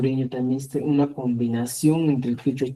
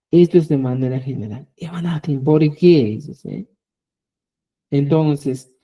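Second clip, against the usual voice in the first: usual speaking voice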